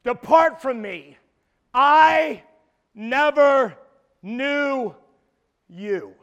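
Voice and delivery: loud, word-by-word pronouncement, fading on the last; solemn judgment. Acted. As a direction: pause after each word like a sentence. Quoting Christ's judgment.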